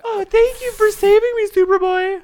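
high, loud damsel cry; damsel in distress. Pretend. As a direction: play the helpless victim.

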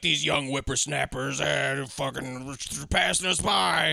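slow put-on old-timer grumble; mock an old man griping about the young; grumbling old man. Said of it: "this old dude is that".

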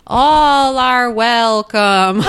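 very slow, drawn-out movie psychic; medium impression. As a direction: imitate a theatrical séance call. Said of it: "she starts with the".

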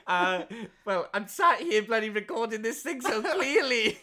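loud, high, laughing comeback; laughing protest. Real, not acted. Actor teased about his career, laughing protest. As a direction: protest loudly through laughter.

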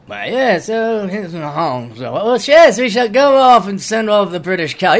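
calm, mock British accent, unbothered; subdued British mock. Mocking calm British soldiers, fake British accent. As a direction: play an unfazed Briton in a mock British accent. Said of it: "very tame, very subdued".

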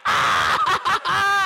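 high re-enacted laughing wail; uncontrollable laughing shriek. Student answering the offended administrator, uncontrollable laughing shriek. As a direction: burst into a high, helpless laughing cry. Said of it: "I'm laughing uncontrollably".

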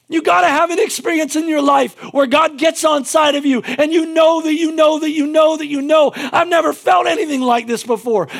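high, loud, rousing preacher cry; shouting preacher at full force; full-force preaching. Acted. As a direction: fire up the whole congregation. Preaches to the congregation.

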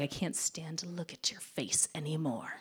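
whispered, seething order; retold angry demand. Ordering the boyfriend to stop the car, whispered fury. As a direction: hiss it through the anger.

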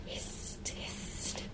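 unvoiced hissing hist; hushing hiss. A narrator's reading. Snakes hushing the room, whispered hist. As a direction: breathe out a sharp hushing hist.

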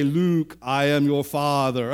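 lightly voiced movie villain line; Darth Vader quote. Preacher quoting a T-shirt, a hint of Darth Vader. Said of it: "T-shirts that say".

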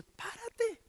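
"Párate" said soft and high, falling gently; calm, quiet "Párate" as God speaking; God's gentle command. Acted. God calmly telling the panicking believer to stand, softly. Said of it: "Y Dios dice".

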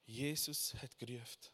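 ordinary talk, nothing marked

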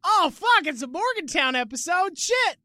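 high, loud dismayed yelling; panicked shout. Retold or imitated. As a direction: yell it in sudden dismay. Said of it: "sometimes you're like".

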